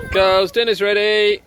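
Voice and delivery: calling out loud to the family; yelling across the room. Real, not acted. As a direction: call them to the table.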